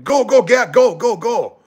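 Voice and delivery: high-pitched mock anger, shooing someone away; imitated angry saleswoman. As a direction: mimic a mad vendor chasing him off. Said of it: "the salesperson was mad at me".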